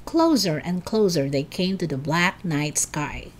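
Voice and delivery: usual speaking voice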